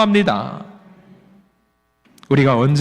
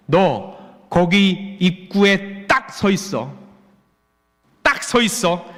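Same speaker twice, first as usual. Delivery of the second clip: stern God commanding; much slower than usual. Acted. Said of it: "하나님께서 만나는 그 호렙산 입구에서 엘리야에게".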